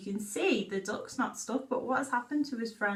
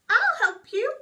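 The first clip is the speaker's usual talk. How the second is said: high, loud frog call; croaking frog; 13 semitones higher, 11 dB louder than usual. Acted. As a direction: pipe up eagerly as a little frog. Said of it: "and croaks".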